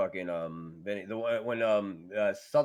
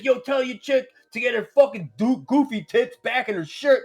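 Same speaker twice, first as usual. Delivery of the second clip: higher and louder heckling; mock heel rant; 10 semitones higher, 5 dB louder than usual. Vinny insulting a fan at the rail, mock rant. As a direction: yell at a fan over the rail. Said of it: "He's like".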